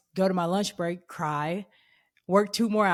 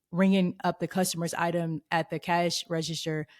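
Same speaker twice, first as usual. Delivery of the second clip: usual speaking voice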